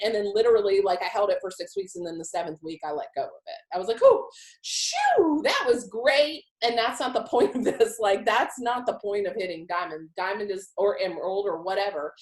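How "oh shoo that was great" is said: high, loud retold "phew" of relief; mock relief, pitched up and louder; relieved sigh of triumph. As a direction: act out being glad it's over.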